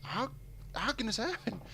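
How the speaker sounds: halting disbelief, rising high at the end; retold amazement. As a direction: marvel at it, pausing between words. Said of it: "it just amazed me".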